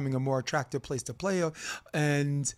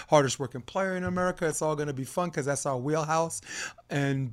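ordinary talk

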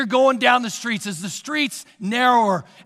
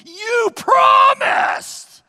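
high, loud, drawn-out wail; a child's hurt cry of you promised; kids protesting; 9 dB louder, much slower than usual. Retold or imitated. Kids wailing at their mom, high loud and slow. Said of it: "they say that other statement".

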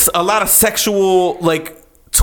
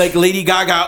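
ordinary delivery

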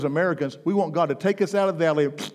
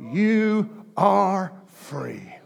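You are free, stretched out very slowly; slow declaration; 5 semitones higher, 5 dB louder, much slower than usual. Acted. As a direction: lean on each word and hold it. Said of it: "drawn-out declaration".

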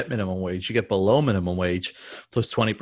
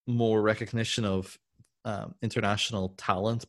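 normal talking voice

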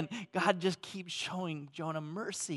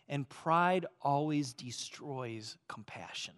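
hushed whisper; 4 semitones lower, 6 dB quieter than usual. Acted. Pastor quietly revealing Jonah's pride, a soft whisper.

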